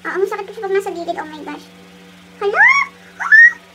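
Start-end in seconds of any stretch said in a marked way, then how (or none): 2.4-3.7 sudden cry of pain; pained yelp. Real, not acted. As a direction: yelp as the mask pulls. Sharp pain from peeling.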